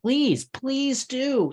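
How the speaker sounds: hammed-up please; mock plea. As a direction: beg the listeners theatrically. Pleading to listeners for fact check.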